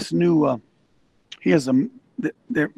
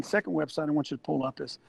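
ordinary delivery

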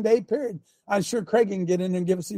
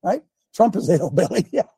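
ordinary talk

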